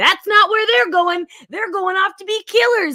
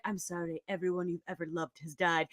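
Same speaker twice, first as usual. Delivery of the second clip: much lower, much softer doctor voice; mock somber doctor; 17 dB quieter than usual. Doctor telling the girl her family died, hushed and low. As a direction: deliver grim news in a hushed low voice.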